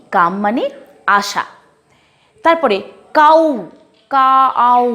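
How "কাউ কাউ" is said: কাউ said with a clear আ onset; English word pronunciation. Shown as a demonstration. Teacher modelling cow for the class, clear and careful. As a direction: stretch the আ in কাউ. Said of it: "আ আসছে কিন্তু".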